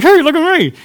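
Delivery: a hint of a cat showing off its catch; proud cat. Pastor voicing the cat showing off its prey. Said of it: "is like".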